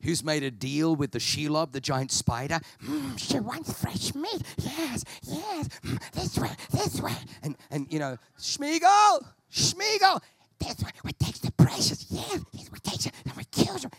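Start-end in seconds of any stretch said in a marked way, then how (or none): 2.8-7.2 high, slow creepy creature hiss; Gollum voice. Acted. Preacher voicing Schmeagol luring Frodo, high hissing creature voice. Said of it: "with Schmeagol". 8.4-13.9 high, loud then hissed creature voice; Gollum voice. Acted. Preacher voicing Schmeagol plotting to kill, high hissing creature voice. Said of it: "with Schmeagol".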